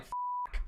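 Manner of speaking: whispered cut-off F sound; cut-off whispered cuss. Shown as a demonstration. Demoing his cuss beeper with a breathy F-. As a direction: start to cuss, then stop short. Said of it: "when I feel the urge to cuss".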